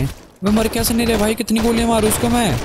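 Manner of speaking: frustrated outburst. Real, not acted. Enemies won't die, frustrated gamer.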